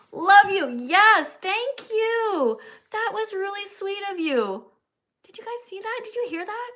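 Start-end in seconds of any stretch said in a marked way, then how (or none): 0.1-4.6 much higher and louder, gushing thanks; delighted gushing. Real, not acted. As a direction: gush over the kind message. Gushing over a sweet tip.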